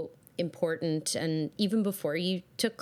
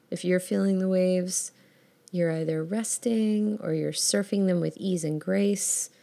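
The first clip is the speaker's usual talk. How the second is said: ordinary talk, nothing marked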